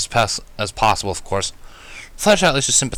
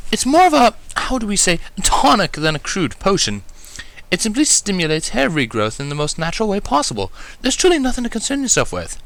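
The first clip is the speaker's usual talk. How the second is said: high, refined lady reassuring breezily; Rarity, confident; 10 semitones higher than usual. A narrator's reading. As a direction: wave away the worry with elegant ease. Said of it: "assured confidently".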